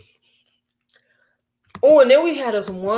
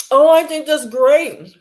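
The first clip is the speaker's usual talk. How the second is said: higher, louder, slow fake enthusiasm; mock kiss-up; 6 semitones higher, 4 dB louder, slower than usual. Coworker kissing up in meetings, slow gushing mock praise. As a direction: gush like a coworker sucking up. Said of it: "they always like".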